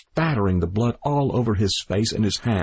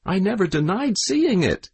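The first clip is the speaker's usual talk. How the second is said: appealing; 11 semitones higher than usual. A narrator's reading. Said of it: "appealing to the onlookers".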